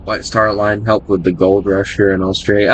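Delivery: normal talking voice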